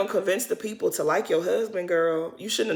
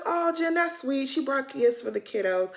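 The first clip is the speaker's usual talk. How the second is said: sweet high voice; 6 semitones higher, 3 dB quieter than usual. Retold or imitated. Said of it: "I'm like".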